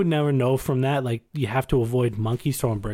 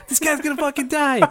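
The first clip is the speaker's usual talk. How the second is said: onlookers' alarm; 13 semitones higher than usual. Retold or imitated. Retelling the crowd watching the man in the muck, high mock panic. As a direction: squeal it like a horrified onlooker. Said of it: "everyone's watching, like".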